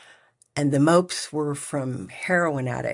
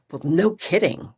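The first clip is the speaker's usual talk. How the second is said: a louder, higher snapped retort, retold; mad retort; 4 semitones higher, 5 dB louder than usual. Answering the nurse's remark with a mildly louder mad retort. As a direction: toss it back at him, annoyed. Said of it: "and I was really mad".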